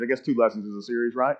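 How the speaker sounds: ordinary talk, nothing marked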